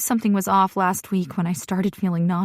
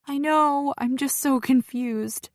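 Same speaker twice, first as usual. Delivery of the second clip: confused, tearful; 7 semitones higher, slower than usual. Acted. Tearful daughter on phone.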